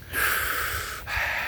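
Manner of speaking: long breathy exhale to calm down; deep calming sigh. Real, not acted. Calming himself after a rant, deep sigh. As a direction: let the breath out slowly to settle. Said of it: "let me do some deep breathing".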